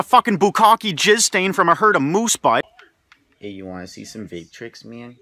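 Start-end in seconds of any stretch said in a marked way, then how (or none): 3.4-5.2 stoner vaper. Acted. Vape guy offering tricks, low and very soft. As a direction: mumble it like a proud vaper.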